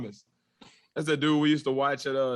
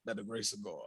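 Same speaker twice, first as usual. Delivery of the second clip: whisper; 5 semitones lower, 15 dB quieter, faster than usual. Pretend. Whispered blessing.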